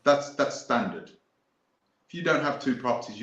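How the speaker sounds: normal talking voice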